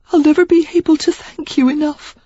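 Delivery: Sarah thanking her rescuer, tearful; tearful gratitude. A narrator's reading. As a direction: thank him through the tears. Sarah grateful after tears.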